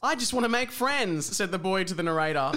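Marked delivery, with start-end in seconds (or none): 0.0-1.2 lightly voiced higher-pitched boy; a hint of a wistful boy; pleading boy. Boy asking the narrator for friends, mildly pleading.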